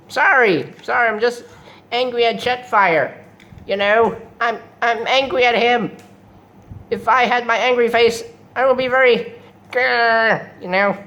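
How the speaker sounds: high, petulant cartoon villain; Starscream. Starscream apologizing for being angry at Jetfire, high petulant voice. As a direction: whine and growl in hammed-up grievance. Starscream explains his anger.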